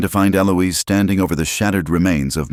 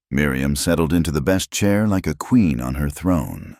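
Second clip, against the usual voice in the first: ordinary delivery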